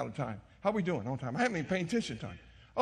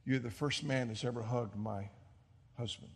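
soft tender quote; 6 semitones lower, 8 dB quieter than usual. Retold or imitated. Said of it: "she said".

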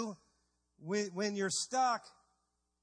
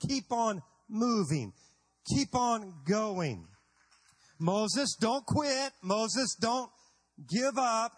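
louder, urgent exhortation with pauses; preaching; 3 dB louder, slower than usual. Acted. Preacher urging the crowd not to quit, loud and urgent. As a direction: drive it home to the congregation.

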